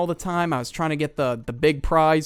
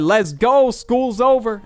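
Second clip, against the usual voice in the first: retold schoolkid cheer, louder and higher; acted excitement; 4 semitones higher, 3 dB louder, slower than usual. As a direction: celebrate like a kid let out of school. Schoolkids celebrating end of day.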